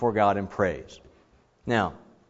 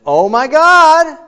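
much higher, loud, drawn-out cry; scream to God; 12 dB louder, slower than usual. Shown as a demonstration. Psalmist screaming to God in distress, loud and high. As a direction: scream it to heaven in anguish. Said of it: "a scream to God".

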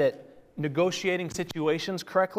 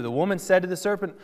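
ordinary talk, nothing marked

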